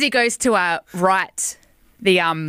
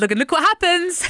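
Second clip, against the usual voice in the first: higher, put-on cute protest; cutesy innocence; 8 semitones higher than usual. Driver pleading with the officer, cutesy innocent. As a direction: play the innocent, pitched up. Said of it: "putting on that whole cute".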